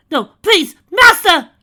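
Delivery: very high, very loud pleading scream; desperate cry. Acted. Mare begging her master Conquest for mercy, very high loud desperate scream. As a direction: beg for your life. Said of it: "cried the blue Pegasus".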